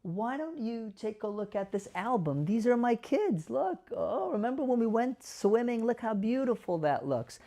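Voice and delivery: upbeat, coaxing show-and-tell; lively caregiver steering mom to photos; cheerful redirect. Shown as a demonstration. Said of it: "I'm gonna say".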